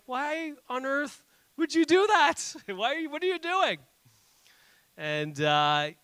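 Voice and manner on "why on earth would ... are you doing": a half-laughing outraged parent; exasperated parent. Retold or imitated. Dad to daughters who smashed the tiles, mock outrage. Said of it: "and we're like".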